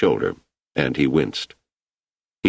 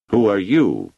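a raised, carrying challenge; loudly; 5 semitones higher, 4 dB louder than usual. A narrator's reading. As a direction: call it out so the whole crowd hears. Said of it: "He said it loudly".

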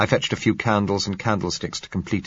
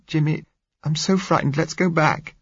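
frightened plea; 10 semitones higher than usual. A narrator's reading. Blind girl pleading with Jimmy, frightened and high. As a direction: beg him not to leave, scared.

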